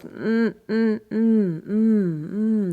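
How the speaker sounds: repeated soft open hums. Shown as a demonstration. Coach repeating soft open hums for the class. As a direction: hum gently with teeth apart. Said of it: "There's more space between your teeth".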